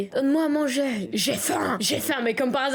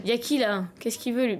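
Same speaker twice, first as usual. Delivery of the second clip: ordinary talk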